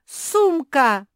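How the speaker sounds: high, full-voiced yell; loud wail. A narrator's reading. Beatrisa sees her bag is gone, yelling loudly. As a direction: yell it out in alarm. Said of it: "завопила Беатриса".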